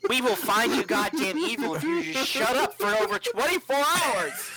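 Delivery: high-pitched, fed-up telling-off; exasperated scolding. Acted. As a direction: scold a nagging companion, pitch climbing. Exasperated player scolds the talking sword.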